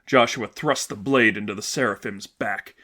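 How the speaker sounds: ordinary delivery, nothing marked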